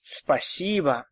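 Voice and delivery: normal talking voice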